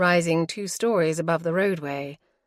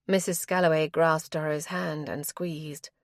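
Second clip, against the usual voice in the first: plain reading voice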